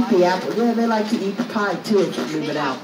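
ordinary talk, nothing marked

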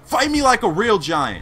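loud mocking challenge at the boss; taunting shout. Real, not acted. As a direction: call the giant out, loud and high.

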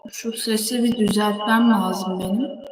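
normal talking voice

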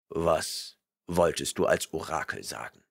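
stern barbarian rebuking a liar; gruff barbarian. A narrator's reading. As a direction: rumble your disgust. Conan's voice.

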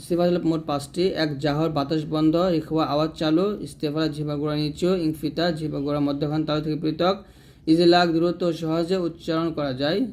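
ordinary talk, nothing marked

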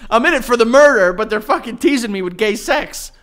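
laughing loudly while ranting; half-shouted through laughter. Real, not acted. Laughing rant about the show.

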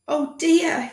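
high, anxious fussing doctor voice; a worried doctor frets over a form; flustered doctor. Acted. To the boy, panicky doctor voice.

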